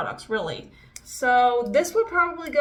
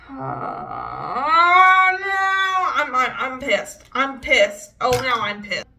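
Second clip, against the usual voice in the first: frustrated; 7 dB louder, slower than usual. Real, not acted. Makeup failing again.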